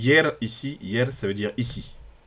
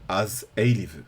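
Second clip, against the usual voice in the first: poem reading; slower than usual. Acted. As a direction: declaim each line slowly, like a poem. Reads the Icelandic poem aloud. (lecture lente et forte d'un poème islandais)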